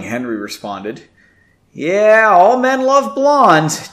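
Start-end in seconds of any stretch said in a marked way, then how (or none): 1.7-3.9 slow, high forced stage whisper; slow breathy aside; strained whisper. A narrator's reading. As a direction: push a whisper through a sore throat. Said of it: "Janey whispered".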